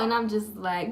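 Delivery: usual speaking voice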